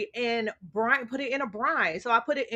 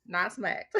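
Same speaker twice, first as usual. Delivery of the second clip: laughing correction; 6 semitones higher than usual. Real, not acted. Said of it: "I said the wrong word".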